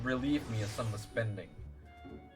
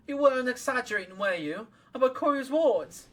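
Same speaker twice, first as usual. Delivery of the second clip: put-on young hero voice; 11 semitones higher, 4 dB louder than usual. Voicing young hero character.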